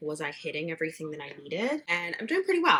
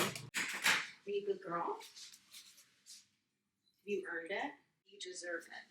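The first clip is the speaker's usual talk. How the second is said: soft, slow, cooing pet talk; 12 dB quieter, slower than usual. Real, not acted. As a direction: coo gently to the cat. Talking to the cat.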